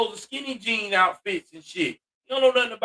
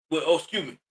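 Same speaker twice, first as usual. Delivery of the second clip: normal talking voice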